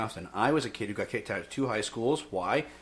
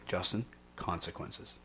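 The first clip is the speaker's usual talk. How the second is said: hushed, slow, nearly voiceless reminder; soft, low, drawn-out whisper; whispered warning; 4 semitones lower, 11 dB quieter, slower than usual. Retold or imitated. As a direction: imitate a mentor whispering it slowly in passing. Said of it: "He would whisper it".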